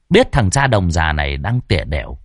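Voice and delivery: usual speaking voice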